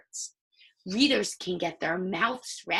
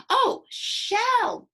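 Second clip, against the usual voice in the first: high, loud "Oh" of pretend discovery; mock discovery; 14 semitones higher, 6 dB louder than usual. Teacher playing a reader who suddenly gets the word. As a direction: act as if it just clicked. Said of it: "That makes sense".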